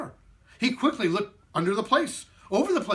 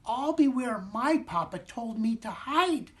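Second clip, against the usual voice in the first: very high, proud little squeak; squeaky matzo man; 10 semitones higher than usual. Acted. Matzo man revealing his hiding place, high squeak. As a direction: boast in a tiny voice.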